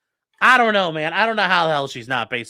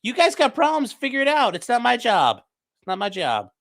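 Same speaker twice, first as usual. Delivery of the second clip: higher, mock dismissive boss voice; mocking impression; 7 semitones higher than usual. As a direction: toss it off like a lazy boss brushing them off. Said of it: "He's like".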